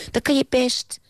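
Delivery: very high-pitched, emphatic cry; exclaimed encouragement. A narrator's reading. Old man encouraging the doubting boy, high emphatic exclamation. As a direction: urge him on in a high voice. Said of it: "riep de oude man".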